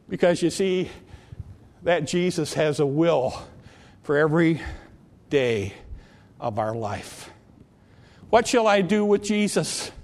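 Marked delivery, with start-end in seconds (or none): none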